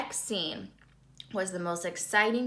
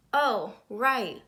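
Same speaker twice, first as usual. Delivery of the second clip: usual speaking voice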